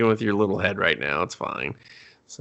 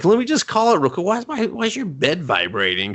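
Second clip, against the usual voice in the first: mock surprised question; 8 semitones higher than usual. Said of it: "He's like".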